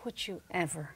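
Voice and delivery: sweetly polite, dropping to near hush; faux-nice request, soft and sugary; mock polite. Stewardess to arrogant passenger, sweetly polite. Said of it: "as nicely as she could".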